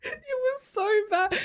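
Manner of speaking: high, helpless laughter through the words; cracking up. Real, not acted. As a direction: barely get it out for laughing. Cracking up at an impression.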